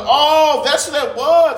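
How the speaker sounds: loud, high drawn-out "oh" of realization; retold realization. Telling friend how the smell clicked, loud surprise. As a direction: act out the penny dropping, high and loud.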